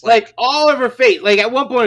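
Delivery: normal talking voice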